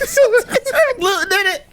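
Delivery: high, squeaky, laughing words; cracking up so hard the words break. Real, not acted. Host repeating the punchline while laughing helplessly.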